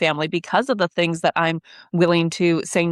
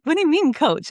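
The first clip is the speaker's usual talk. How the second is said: high confused quote; 10 semitones higher than usual. Retold or imitated. Retold confused question, laughing.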